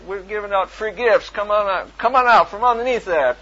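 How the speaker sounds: loud, high coaxing, rising in force; coaxing call. Retold or imitated. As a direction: lure him out cheerfully. Calling him out from under car.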